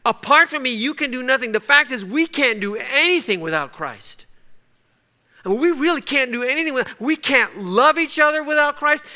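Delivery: high, loud climactic preaching; preacher pressing the point home; preaching at full force. Acted. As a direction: build to a fervent peak.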